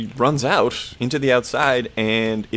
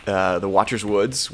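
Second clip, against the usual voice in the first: normal talking voice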